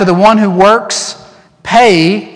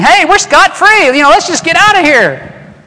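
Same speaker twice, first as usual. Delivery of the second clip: much higher, breezy put-on voice; carefree slacker; 12 semitones higher, 5 dB louder than usual. Preacher mocking a listener who wants to skip church, high carefree voice. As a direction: toss it off like you're skipping out. Congregant imagining freedom from church.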